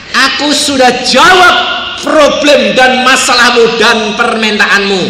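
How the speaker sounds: God proclaiming the prayer is answered. Retold or imitated. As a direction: boom out God's answer. Voicing God's answer.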